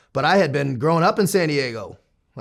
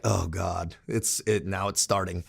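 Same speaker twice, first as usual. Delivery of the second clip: retold dread; 6 semitones lower, 8 dB quieter, slower than usual. Own dismayed reaction, retold.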